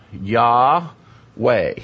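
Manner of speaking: Yahweh slowly split as Yah, way; two syllables. Shown as a demonstration. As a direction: break Yahweh into two long halves. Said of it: "broke the word in the two syllables".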